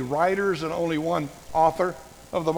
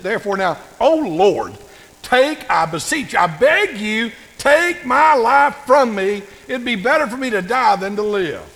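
louder, slowing, wailing plea to die; dramatic despairing Jonah; 3 semitones higher, 7 dB louder than usual. Retold or imitated. Preacher acting Jonah begging God to take his life, loud wailing plea. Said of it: "do you not like the way he says it?".

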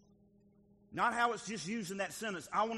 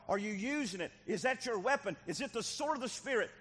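normal talking voice

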